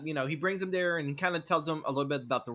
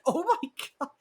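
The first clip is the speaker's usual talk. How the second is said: much higher, louder gasp of disbelief; shocked; 13 semitones higher, 5 dB louder than usual. Retold or imitated. Speaker recalling his shock at the film, high gasp. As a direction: squeal at what the film just did.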